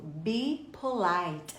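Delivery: "Be polite" drawn out word by word; slow, spaced-out "Be polite"; slow repeat-after-me model. Shown as a demonstration. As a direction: model "Be polite" at half speed for learners. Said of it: "Repete comigo pra você não esquecer".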